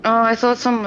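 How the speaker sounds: usual speaking voice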